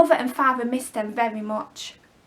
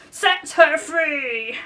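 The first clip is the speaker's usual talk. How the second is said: lightly voiced Hook, slow and stern; a mild pirate captain command; Captain Hook impression; 4 semitones higher, 4 dB louder, much slower than usual. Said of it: "In a voice that sounded just like Captain Hook's".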